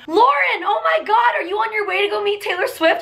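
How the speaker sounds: high-pitched fan squeal, imitated; excited fans. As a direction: imitate a thrilled fan spotting someone. Fans recognizing her, acted out.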